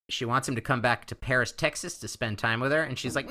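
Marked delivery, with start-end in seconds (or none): none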